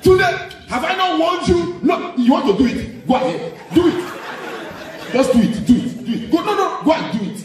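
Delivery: high shouted parental dare; mock-furious Nigerian parent daring the child; angry parent. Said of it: "They go like".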